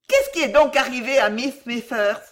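high, loud startled question; alarmed question. A narrator's reading. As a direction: ask in alarm what has happened. Mistress startled by a pupil's scream.

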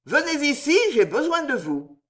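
high, carrying summons; innocent call. A narrator's reading. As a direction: call him over from the far bank. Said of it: "cria, fort innocemment, du bord opposé".

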